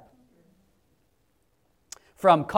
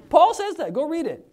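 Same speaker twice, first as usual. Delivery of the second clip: much higher, louder exclamation; excited emphasis; 9 semitones higher, 6 dB louder than usual. Real, not acted. Preacher stressing Paul's words to the congregation, excited. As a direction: burst out with it.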